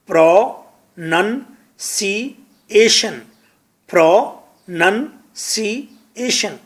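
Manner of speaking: پروننسیشن drawn out very slowly, syllable by syllable; slow model pronunciation. Shown as a demonstration. Teacher slowly models پروننسیشن for students to repeat. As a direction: stretch out پروننسیشن as "pro-nun-see-ay-shun". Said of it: "کہیں پروننسیشن".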